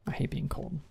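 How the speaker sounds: breathy whispered complaint. Real, not acted. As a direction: whisper it, shivering and sleepy. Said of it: "I'm eepy and I'm cold".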